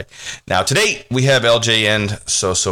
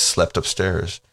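plain reading voice

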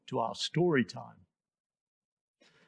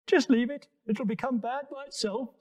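lofty, higher-pitched giraffe; 8 semitones higher than usual. Acted. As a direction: speak up high like a tall giraffe. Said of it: "said the giraffe".

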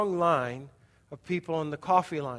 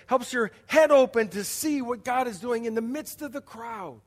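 louder, higher impassioned preaching; fervent sermon climax; preaching at full force; 4 semitones higher, 4 dB louder than usual. Acted. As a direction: lift the room with conviction. Preaching crescendo about the Spirit.

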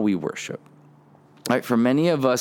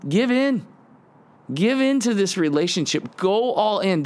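high, pressing call to let go; urging plea; 7 semitones higher than usual. Retold or imitated. Preacher voicing David urging listeners, high and pressing. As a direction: push them to give in, pitch rising. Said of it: "And David's going".

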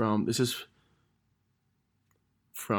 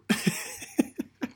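high embarrassed laugh; 13 semitones higher than usual. Real, not acted. Cringing at own eighth-grade message.

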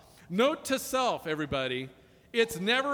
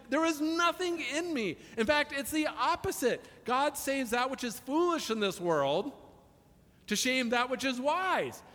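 higher, pressing preaching voice; impassioned preaching; 6 semitones higher than usual. Acted. Pastor presses the congregation on God's love, impassioned. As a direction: drive it home to the church.

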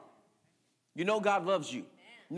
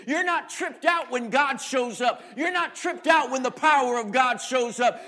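loud, driving sermon build; rising into a shouted sermon climax; preaching at full force; 6 semitones higher, 8 dB louder than usual. Acted. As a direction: preach it hard to the crowd.

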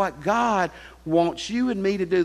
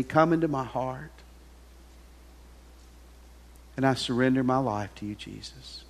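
prayer; 3 semitones lower than usual. Acted. Leads sinner's prayer.

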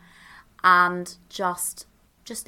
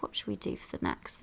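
much softer, breathy whisper to herself; 8 semitones lower, 11 dB quieter than usual. Real, not acted. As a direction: whisper the question privately. Repeats question as whisper.

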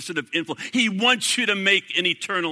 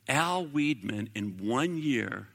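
normal talking voice

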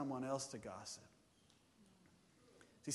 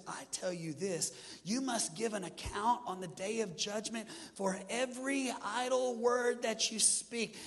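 full-force preaching; 5 semitones higher, 3 dB louder than usual. Acted. Pastor warning congregation about judgment for their words, preaching at full force. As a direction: preach judgment day with rising fire.

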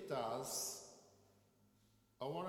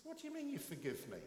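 higher, surprised challenge; baffled reply; 8 semitones higher than usual. Retold or imitated. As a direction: sound taken aback and affronted.

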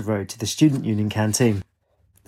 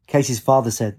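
ordinary delivery

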